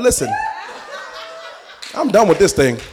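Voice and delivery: hammed-up dealer setting a meetup; mock dealer on phone. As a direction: caller laughing through a shady pitch.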